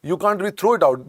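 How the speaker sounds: ordinary talk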